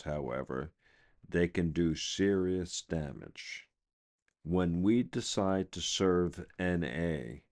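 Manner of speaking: ordinary delivery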